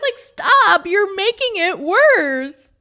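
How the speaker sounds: high, loud, laughing protest; exasperated plea. Acted. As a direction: beg her to quit, drawing it out. Pleading with clip's tactless woman.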